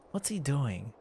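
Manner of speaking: ordinary talk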